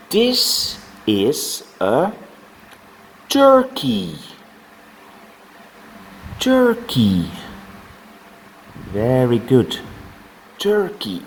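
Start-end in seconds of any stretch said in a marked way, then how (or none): 0.1-7.3 high, sing-song model of turkey; exaggerated teacher voice. Shown as a demonstration. Teacher modelling animal names. 10.6-11.2 exaggerated teacher voice. Shown as a demonstration. Teacher modelling animal names.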